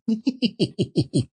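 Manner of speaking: put-on giddy old man's laugh; breathy gleeful giggle. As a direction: laugh at his own joke. Scrooge laughs.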